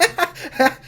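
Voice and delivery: high acted giggle; giggling. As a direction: giggle giddily in character. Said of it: "like, giggling about it".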